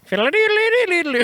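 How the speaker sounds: high, far-off fake Irish singsong; hammed-up Irish jig noise; mock Irish lilt. Cohost opening the podcast in a fake Irish lilt.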